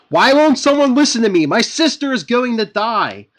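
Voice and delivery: high, loud, anguished cry for help; desperate yell. Retold or imitated. Sister yelling to be heard about her dying sister. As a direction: shout it like nobody will listen. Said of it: "Alicia yells".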